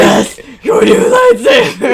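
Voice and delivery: very high, very loud crazed yell; manic shout. Acted. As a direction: scream it like a mad inventor. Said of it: "what voice to do for this random person".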